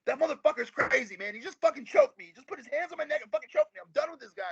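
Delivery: a shaken sound man venting in a high voice; retold yelling. Sound man yelling to the owner after being choked, frantic high complaint. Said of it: "He's yelling at the owner".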